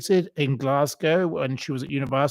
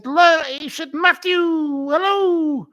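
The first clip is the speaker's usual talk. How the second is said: much higher, slow, gushing mock greeting; Lorraine Kelly greeting; 12 semitones higher, 4 dB louder than usual. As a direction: coo the name out like a delighted TV host. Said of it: "and Lorraine had said".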